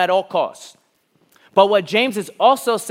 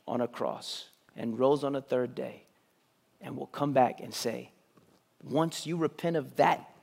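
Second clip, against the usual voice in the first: soft, lowered sermon voice; hushed preaching; 5 semitones lower, 8 dB quieter than usual. Real, not acted. Preacher telling the congregation the good news, hushed preaching. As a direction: bring it right down, softly, with long pauses.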